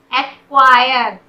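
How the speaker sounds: "एक्वायर" slow and loud, drawn out; "एक्वायर" stretched out for learners, firmly; slow model pronunciation. Shown as a demonstration. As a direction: say "एक्वायर" deliberately as ak-kwire. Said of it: "सी का sound नहीं हो रहा".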